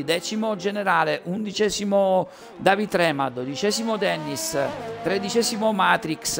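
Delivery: fast race commentary, rising with the action; live play-by-play. Real, not acted. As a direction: call the qualifying lap live. Reading out results.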